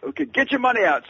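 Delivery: high, loud, brusque demand; high-pitched, loud impersonation of a famous singer on the phone. Retold or imitated. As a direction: bark it down the phone like a star. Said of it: "shouting so loudly".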